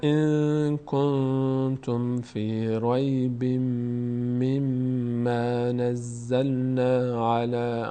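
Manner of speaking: slow, sustained tajweed chanting; measured, unhurried recitation; Quran recitation. Shown as a demonstration. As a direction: hold nasals and long vowels on an even note. Recites verse from start.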